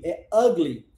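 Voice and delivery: "ugly" with the English A vowel, "agli"; corrected English pronunciation. Shown as a demonstration. Teacher corrects the pronunciation of "ugly" for students. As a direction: land "ugly" clearly as "agli". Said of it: "não é ugly".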